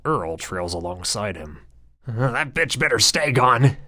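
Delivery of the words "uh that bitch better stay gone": higher, jittery nervous voice; frenzied chatter. Acted. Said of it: "Bonham chatters in a frenzy".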